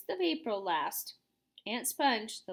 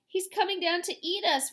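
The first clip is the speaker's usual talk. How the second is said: high wailing panic; 7 semitones higher, 4 dB louder than usual. A narrator's reading. Old grasshopper wailing as the cloud man nears. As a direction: wail it out in fear. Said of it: "wailed the old green grasshopper".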